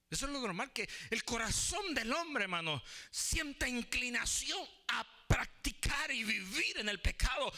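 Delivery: high, loud-edged pulpit emphasis; forceful preaching. Real, not acted. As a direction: drive each phrase home like a preacher.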